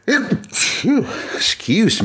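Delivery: slow, high apology right after a sneeze; sneezing. Real, not acted. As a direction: catch your breath after sneezing. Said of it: "I'm gonna sneeze".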